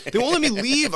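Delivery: high, loud protest; exasperated complaint. Retold or imitated. Patient complains to doctor about being kept, exasperated.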